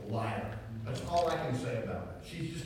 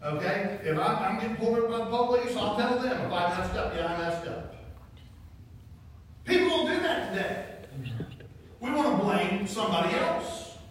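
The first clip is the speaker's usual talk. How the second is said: preaching at full force; 6 semitones higher, 4 dB louder than usual. Real, not acted. Preacher urging the congregation to admit mistakes, fervent.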